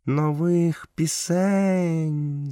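high, drawn-out panther call; growling panther. A narrator's reading. Panther calls out the season of new songs, growling. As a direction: stretch the words out slowly, pitched well up. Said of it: "загарчала пантера".

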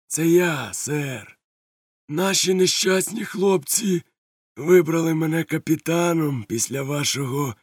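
high, slow oily courtesy; sly pirate Silver. Acted. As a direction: play the smooth pirate. Silver answers the captain.

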